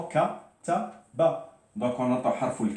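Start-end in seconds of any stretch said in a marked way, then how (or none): 0.0-1.6 Kataba split into ka, ta, ba; teacher spelling out each sound of Kataba. Shown as a demonstration. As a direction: say Kataba slowly, syllable by syllable, pitched high. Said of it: "Qu'est-ce qu'on entend?".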